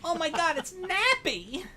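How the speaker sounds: high, put-on gushing voice, last word drawn out; mock fan squeal. Greeting a friend's Napoleon figure, mock fan squeal. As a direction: squeal in a silly high voice, stretching the name.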